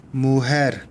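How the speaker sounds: 무헤르 with a slight stress on 헤르. Shown as a demonstration. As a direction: lean a little on the final 헤르 of 무헤르. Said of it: "뒤쪽 부분에다가 약간 좀". (무헤르의 '헤르'에 약간 강세)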